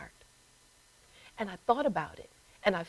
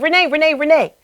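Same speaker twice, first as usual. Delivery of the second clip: mother calling Renee, louder and higher, again and again; insistent calling; 6 semitones higher, 8 dB louder than usual. Retold or imitated. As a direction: call her name to pull her back. Mother calling her name insistently.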